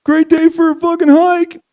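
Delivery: high, chirpy mock hiker voice, put on; mocking a hiker who has no idea about the bear; clueless happy hiker. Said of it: "You're just".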